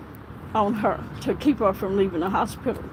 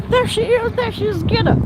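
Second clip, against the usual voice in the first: high, louder mock yell pointing her out; retold urgent call; 10 semitones higher, 4 dB louder than usual. Hurt detective urging officer to seize patient, high urgent call.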